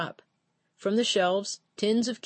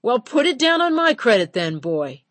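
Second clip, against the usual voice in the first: higher, louder, commanding; captain firmly overruling the clerk, loud and higher; stern retort; 5 semitones higher, 8 dB louder than usual. A narrator's reading. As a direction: overrule him with force.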